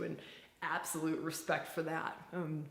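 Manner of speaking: ordinary talk, nothing marked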